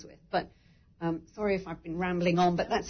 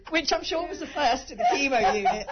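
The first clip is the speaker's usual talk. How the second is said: laughing; 7 semitones higher, 4 dB louder than usual. Real, not acted. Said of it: "laughing so much".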